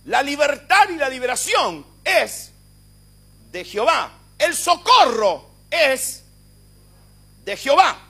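shouted high, very slow and drawn out; fiery Pentecostal declaration; preaching at full force. Acted. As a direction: proclaim it to shake the room. Preacher keeps proclaiming.

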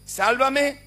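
high shouted plea; imitating people shouting to Jesus; crowd crying out. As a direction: cry "Sálvame" as a desperate crowd would. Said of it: "Qué le gritaban siempre a Jesús".

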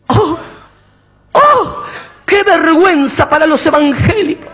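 shrill high oh, hammed-up church gossip; mock horror at the scandal; mock scandalized gasp. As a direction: ham up the shocked church lady, jumping high. Mocking scandalized church member.

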